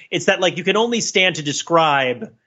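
usual speaking voice

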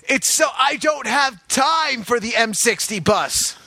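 high-pitched mock outburst; exasperated action-hero shout. Agent stuck crossing town, mock exasperated shout. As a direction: shout like a hero held up by transit. Plays Jack Bauer stuck in transit.

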